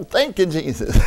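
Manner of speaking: ordinary talk, nothing marked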